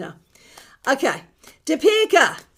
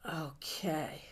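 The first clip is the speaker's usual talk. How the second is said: whispered okay; 7 semitones lower, 18 dB quieter than usual. Real, not acted. Composing herself after tears.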